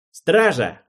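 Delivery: loud, sharp bark of a command; a lord snapping for the guard; barked order. A narrator's reading. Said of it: "рявкнул Алвин".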